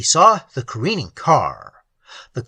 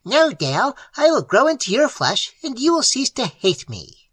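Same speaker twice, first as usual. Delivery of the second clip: shrill taunt; 8 semitones higher than usual. Acted. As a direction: crow over him. Familiar answers Elliot.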